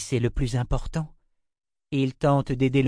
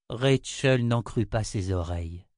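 ordinary delivery

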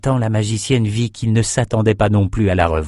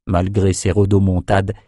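usual speaking voice

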